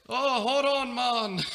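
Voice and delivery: quick high Jamaican accent imitation, one tenant's words; a playful go at a Jamaican accent after being asked. Landlord's son mimicking the Jamaican tenant at the door in a high Jamaican accent. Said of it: "Come on, talk an accent".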